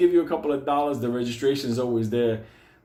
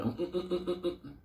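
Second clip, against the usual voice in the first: stubborn high humming; 7 semitones higher, 10 dB quieter than usual. Retold or imitated. Benny refusing at the unemployment office.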